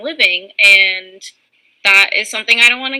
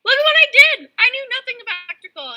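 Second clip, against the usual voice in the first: high, giddy show-off voice; squealing with pride; proud squeal; much faster than usual. Retold or imitated. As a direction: brag like a kid showing off. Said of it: "I was just like".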